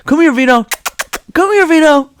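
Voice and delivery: high, loud calling coo; mock dog-owner call. Someone in the park calling their dog, high loud mock coo.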